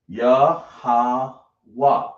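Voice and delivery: Yah, ha, wa each said apart as its own syllable; syllables sounded out. Shown as a demonstration. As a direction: break the word into single sounds for the class. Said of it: "So we got Yah, ha".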